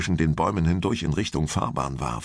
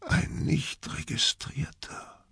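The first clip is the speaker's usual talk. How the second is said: slow, soft whisper; barely voiced, awed boy's whisper; 9 semitones higher, 6 dB quieter, slower than usual. A narrator's reading. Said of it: "flüsterte er nur noch".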